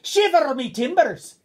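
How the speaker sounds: high, loud, drawn-out exclamation; hammed-up commentator's cry of amazement; Alan McManus. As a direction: belt it out slowly like an amazed commentator. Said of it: "comes from Alan McManus's heart".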